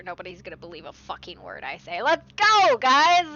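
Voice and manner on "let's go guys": loud call to the group; rally everyone, loud; rallying shout. Real, not acted. Rallying the players.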